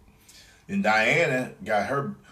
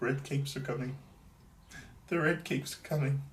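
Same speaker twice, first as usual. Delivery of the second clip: higher, softer theatrical cry; mock warning call; 7 semitones higher, 5 dB quieter than usual. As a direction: announce it like a town crier. Mock announcement.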